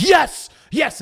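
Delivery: loud, high triumphant yell; screamed out. Retold or imitated. Student yelling in class as the stock jumps, loud triumphant scream. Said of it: "I, like, screamed out".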